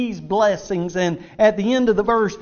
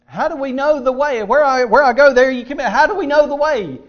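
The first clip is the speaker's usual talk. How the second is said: lightly acted disciple, higher and louder; mildly dramatised plea, pitched up; questioning disciple; 6 semitones higher, 6 dB louder than usual. Voices the disciples' anxious question.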